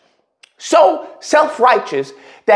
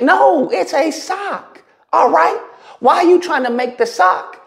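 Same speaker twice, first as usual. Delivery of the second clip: exasperated; 8 semitones higher than usual. Real, not acted. Exasperated retort.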